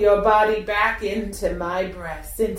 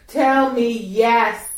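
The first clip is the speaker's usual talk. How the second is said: louder, slow insistent command; firm command; 3 semitones higher, 5 dB louder than usual. Shown as a demonstration. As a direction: demand it, drawn out.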